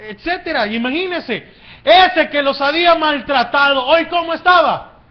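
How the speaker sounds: high, loud preacher's rhetorical question; impassioned preaching. Real, not acted. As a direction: preach it at full force, phrase by phrase. Preaches at full force.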